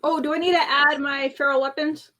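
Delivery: ordinary talk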